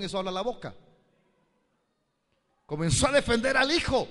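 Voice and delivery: loud, high preaching climax; preaching at full force. Acted. Pastor preaching hard to the congregation, loud and high. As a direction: preach it out with rising fervour.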